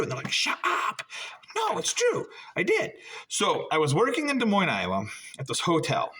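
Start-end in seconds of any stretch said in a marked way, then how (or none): 0.3-2.9 insistent. Retold or imitated. Retold exchange about comedy start.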